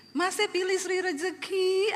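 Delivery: high, slow, stretched wifely voice; put-on sweetness, drawing out the choice; dainty Javanese wife.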